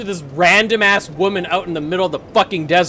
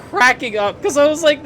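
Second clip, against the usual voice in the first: words through giggles; still laughing; 7 semitones higher, 3 dB louder than usual. Real, not acted. Retelling laughing fit.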